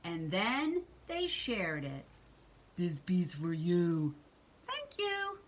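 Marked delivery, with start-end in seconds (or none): 2.8-4.1 low, slow monster voice; lumbering low-pitched storybook monster; Cookie Monster. Acted. Cookie Monster offers a piece. 4.7-5.4 high, dainty thank-you; high-pitched storybook witch; grateful witch. Acted. Witch accepts the piece.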